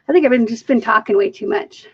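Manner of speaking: ordinary talk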